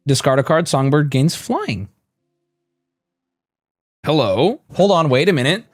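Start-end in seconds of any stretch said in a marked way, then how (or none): none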